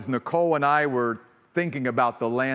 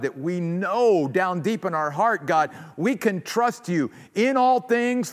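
earnest, slightly louder prayer voice; closing prayer; 2 semitones higher, 3 dB louder than usual. Real, not acted. Pastor praying to close the service, earnest. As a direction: pray sincerely over the congregation.

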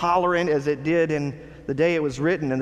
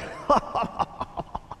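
breathy incredulous laugh, high and soft; religious leaders scoffing at Jesus, a soft mocking laugh; 6 semitones higher, 4 dB quieter than usual. A short unvoiced laugh of disbelief. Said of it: "They're like".

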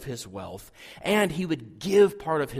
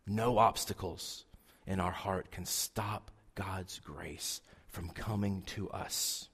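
hushed, much softer whisper; 7 semitones lower, 10 dB quieter, slower than usual. Real, not acted. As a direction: draw them in with a whisper. Intimate climax of sermon point.

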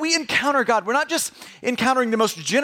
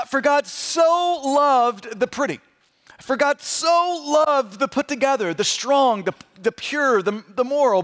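raised pitch, "so" stretched hard; emphatic stress on SO; 4 semitones higher than usual. Acted. Pastor recites the verse he expected, exaggerated "so". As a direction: gush on a long, emphatic "so".

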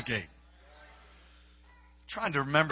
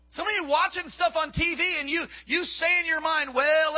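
fiery preaching; 8 semitones higher than usual. Acted. Preacher warns the congregation about the eye gate, at full force. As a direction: drive the warning home to the crowd.

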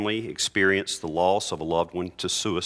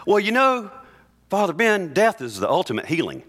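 hammed-up pious cliché; mock parishioner; 9 semitones higher than usual. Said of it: "say this phrase".

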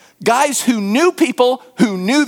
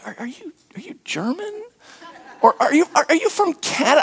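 imitating a hesitant, higher-pitched questioner; tentative guessing; 7 semitones higher, 3 dB quieter than usual. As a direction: stammer out a puzzled guess, pitched up. People puzzled by his accent guess nationality.

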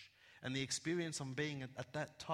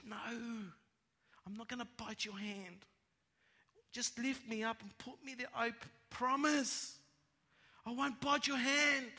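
coaxing snake; 8 semitones higher than usual. Acted. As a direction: coax the boy in a raised pitch, building in volume. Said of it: "And the snake goes".

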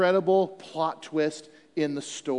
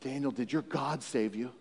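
much softer, hushed question; quiet question; 8 semitones lower, 12 dB quieter than usual. Retold or imitated. King asking Daniel softly after the night. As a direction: ask it softly, almost afraid. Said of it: "He said".

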